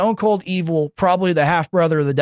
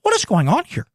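bewildered Tucker asking what's going on; Tucker impression; 4 semitones higher than usual. Streamer imitating Tucker reacting to Zelensky outfit, mock bewilderment. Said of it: "That's my Tucker impression".

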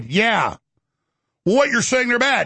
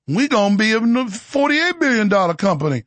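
put-on Cajun accent. Executive brags about the company, mock Cajun accent. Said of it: "the actor doing the coon-ass voice".

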